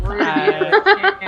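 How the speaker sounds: put-on high, slow, sulky child voice with a laugh; mock offended urchin. DM as the urchin scolding the players, high and sulky. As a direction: pout it out as the prickly kid.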